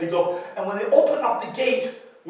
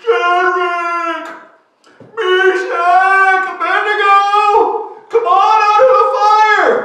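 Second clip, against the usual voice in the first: long, high shouted call; 7 semitones higher, 10 dB louder, slower than usual. Acted. King calling the three out of the furnace, full-voiced holler. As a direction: holler each name, stretching it out.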